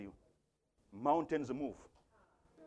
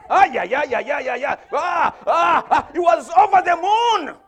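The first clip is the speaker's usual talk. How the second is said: high, loud shouts of excitement; elated preacher shouting for more words; exultant preaching; 15 dB louder than usual. Real, not acted. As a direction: work the congregation up at full force. Preaches at full force.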